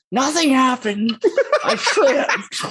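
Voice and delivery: high laughing excuse. Acted. Character caught, laughing excuse.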